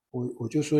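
ordinary delivery

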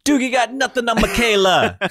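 high, loud mock Jamaican accent. Host boasting about child prodigy Michaela, Jamaican accent. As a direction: crow it in a put-on Jamaican accent. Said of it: "I don't know why the Jamaican accent came out".

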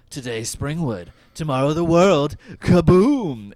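mock villain boast ending in a loud kaboom; Freddy quote. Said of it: "He says".

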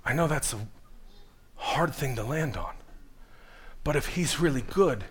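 very soft, halting preacher's voice; hushed preaching. Real, not acted. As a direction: ease gently into the hard truth, with pauses.

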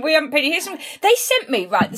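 ordinary talk, nothing marked

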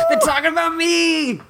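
high, giddy imitation of delight; psyched. Host voicing a hero overhearing talk about himself, psyched. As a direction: gush in a high mock-thrilled voice. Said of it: "He's psyched".

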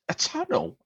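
very high incredulous question; squeaky mock astonishment on TUNNEL; mind blown. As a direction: imitate a colleague who cannot believe it. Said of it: "his mind was blown".

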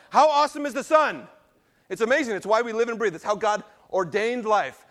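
high, loud, fervent preaching; excited preaching. Real, not acted. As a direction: preach it with fire. Preaching enthusiastically about the sun.

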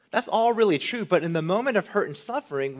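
ordinary talk, nothing marked

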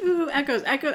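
light reenactment of delighted interest; excited. Retold or imitated. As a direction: perk up with an excited ooh. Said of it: "I was like".

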